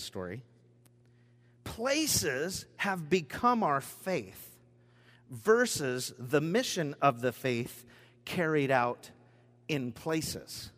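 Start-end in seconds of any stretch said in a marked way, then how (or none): 1.6-10.7 each phrase slowed and weighted; slow emphatic reading. Shown as a demonstration. Preacher reading his key line to the congregation, slow and deliberate. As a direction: read it slowly for weight. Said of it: "I'll read that one more time".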